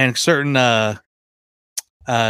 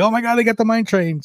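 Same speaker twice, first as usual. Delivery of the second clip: excited gasp; 10 semitones higher than usual. Retold or imitated. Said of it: "I'm like".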